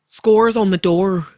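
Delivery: put-on Northern Irish accent. Guest hosts Generation Game on request, Northern Irish accent. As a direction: attempt a game-show catchphrase in Northern Irish. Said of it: "as a Northern Ireland person".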